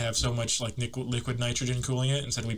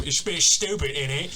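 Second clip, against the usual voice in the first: a louder, higher mock British accent with a rising tag; 3 semitones higher, 5 dB louder than usual. Mocking the UK, a mock British accent. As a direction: take off a Brit saying it's stupid, ain't it. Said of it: "and go".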